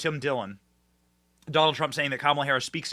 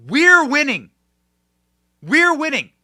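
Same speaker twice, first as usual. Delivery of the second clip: higher and louder, rallying; triumphant shout; 11 semitones higher, 8 dB louder than usual. Real, not acted. Host rallying viewers against gaslighting, triumphant shout. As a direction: shout it like a victory chant. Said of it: "don't let anyone gaslight you".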